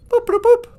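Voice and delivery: very high mock computer beeps. Calling the spaceship computer with high mock beeps.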